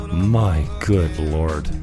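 normal talking voice

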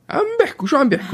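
put-on high, squeaky little girl voice; imitating daughter. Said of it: "إجت قالت لي".

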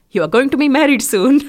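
stern Punjabi mum announcing; imitating mum. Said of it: "my mum said".

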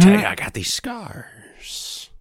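lightly voiced Joker whisper. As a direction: rasp it like the Joker.

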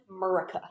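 "America" clipped to 'Murica; slurred 'Murica. Pretend. Joking with friends about speaking American, "America" as 'Murica. Joke mispronunciation of the country's name.

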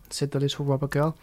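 ordinary delivery, nothing marked

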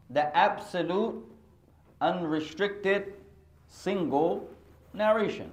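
slow, drawn-out repeat; slow term. Shown as a demonstration. Teacher repeating the term to the class, slowly. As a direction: stretch each word for note-takers. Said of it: "teaching the term".

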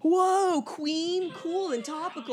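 high, put-on gushing kid; mock kid reaction. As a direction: mimic an overawed kid reacting. Mimics kids overreacting to Queen.

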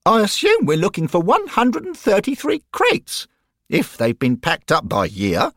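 high trilling schoolboy voice; trilling boy. A narrator's reading. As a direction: trill it like an eager know-all boy. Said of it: "Derek trilled".

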